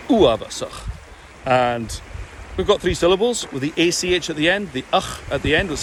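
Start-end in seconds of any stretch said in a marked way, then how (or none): 0.1-0.9 Uavasach with a short, punched first syllable and a final ch; stress on first vowel. Shown as a demonstration. Coach giving the correct pronunciation to students. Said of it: "So we're hitting the first one".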